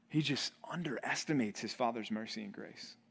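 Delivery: breathy hushed whisper. Real, not acted. Preacher hushing the congregation in a whisper. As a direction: lean in and whisper it.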